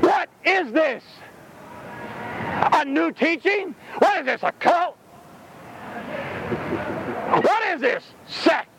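mock skeptic crowd. Preacher voicing doubting onlookers, scoffing.

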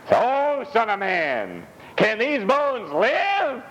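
slow, drawn-out high voice of God; voicing the Lord, slowly. Preacher voices the Lord's question to Ezekiel, slow and high.

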